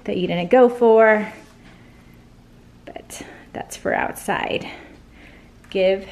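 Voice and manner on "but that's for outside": whispered aside. Real, not acted. Aside about an extra purchase.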